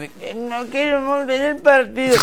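high, drawn-out mock whine; mock lazy whining. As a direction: imitate slackers moaning about work. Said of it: "los flojonazos de mi equipo ya me dijeron".

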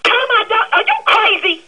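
high, outraged disbelief; furious owner hearing her dog will be tied up outside. Real, not acted. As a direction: spit it back at her, incensed.

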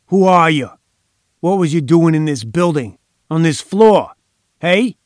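shouting. A narrator's reading. Gangster roaring at captive.

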